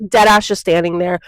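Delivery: ordinary delivery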